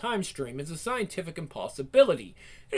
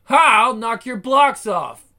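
loud, higher taunting shout; combat taunt; 4 semitones higher, 11 dB louder, slower than usual. Acted. Ash taunting enemies mid-fight, loud and higher. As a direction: shout the threat at them.

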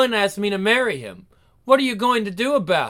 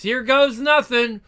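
normal talking voice

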